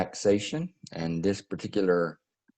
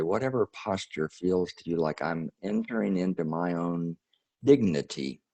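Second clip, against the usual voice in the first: guided meditation; 2 semitones higher than usual. Acted. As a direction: ease the listeners into breathing and release.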